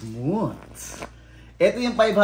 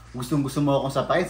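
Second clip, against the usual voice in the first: ordinary delivery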